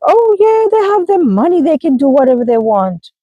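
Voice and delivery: high sarcastic mock of gossip. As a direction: say it like a dismissive know-it-all. Mocking what people say.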